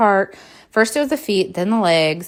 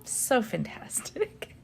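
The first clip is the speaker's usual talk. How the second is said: slow, soft, dry sarcasm; sarcastic; 8 semitones higher, 10 dB quieter, slower than usual. Real, not acted. Birthday gone wrong, sarcastic to viewers. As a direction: say it sweetly but mean the opposite. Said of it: "Can you sense the sarcasm".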